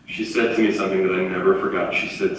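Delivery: ordinary delivery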